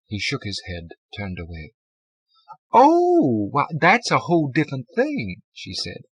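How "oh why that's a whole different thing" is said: high, loud, drawn-out realisation; woman at the desk. A narrator's reading. Woman won over by his plea, high relieved exclamation. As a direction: relent as the desk attendant, voice swooping up.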